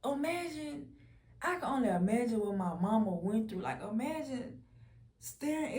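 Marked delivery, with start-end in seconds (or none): none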